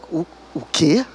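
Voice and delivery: low, soft confused echo; a hint of bewildered disbelief; puzzled what. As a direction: ask back, stunned and lost. Stunned at strange phrase.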